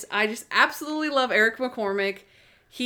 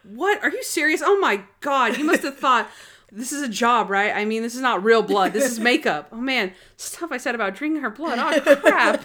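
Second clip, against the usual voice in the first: flustered, hammed-up shock; panicked actor impression; 3 dB louder than usual. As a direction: drop the act and protest in mock panic. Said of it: "it's impression time".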